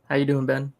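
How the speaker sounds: ordinary talk, nothing marked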